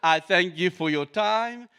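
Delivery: loud stiff robot voice. Pretend. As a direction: thank them like a robot. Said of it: "The robot say thank you".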